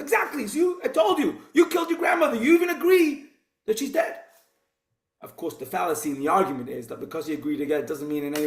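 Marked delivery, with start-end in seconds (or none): 0.0-4.2 much higher, shouted triumph; triumphant gotcha. Retold or imitated. Shliach triumphantly shouting at the campaigner, much higher, mock victory. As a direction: pounce on him like you've caught him out.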